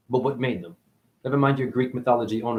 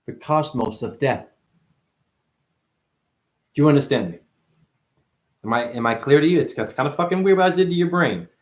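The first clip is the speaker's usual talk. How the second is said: usual speaking voice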